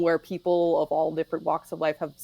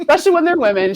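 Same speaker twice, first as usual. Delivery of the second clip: emphatic echo; 10 semitones higher, 8 dB louder, faster than usual. Real, not acted. Echoes co-host's sarcastic aside.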